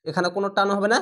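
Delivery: ordinary delivery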